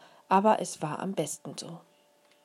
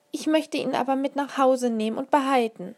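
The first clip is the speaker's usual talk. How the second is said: put-on higher, louder begging child's voice; a small girl pleading to keep her beetle; pleading little girl; 9 semitones higher, 5 dB louder than usual. A narrator's reading. Little girl begs mother to keep the beetle, pleading.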